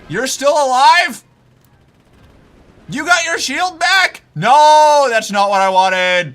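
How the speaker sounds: high, loud disbelieving shout; panicked shout. Real, not acted. Streamer shouting at a surviving enemy, panicked. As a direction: yell in alarm at the enemy.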